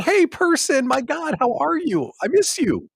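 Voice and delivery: very high, stretched, syrupy greeting; mock sweet email to a lapsed member; gushing host voice. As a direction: coo it like an overeager friend. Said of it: "So I'm like".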